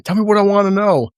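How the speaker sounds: high, urgent imitation of an interrogator; mock interrogation yell. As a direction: demand answers, raising the pitch sharply. Acted interrogation amid a fight.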